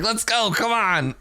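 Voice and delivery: lightly acted high, drawn-out urging; hint of an impatient little tough guy; impatient baby henchman. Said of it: "he just kicks his feet and is like".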